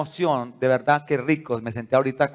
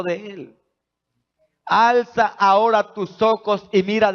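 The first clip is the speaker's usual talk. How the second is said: louder, higher solemn reading of the text; scripture read aloud; 5 semitones higher, 4 dB louder than usual. Acted. Pastor reading Genesis to the congregation, proclaimed scripture reading. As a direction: proclaim the verses over the church.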